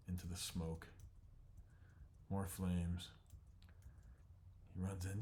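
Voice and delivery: ordinary delivery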